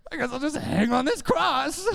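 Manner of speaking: high, hammed-up resignation; mock martyrdom. Believer hanging on a cross of self-pity, mock-tragic. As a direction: play the woeful martyr.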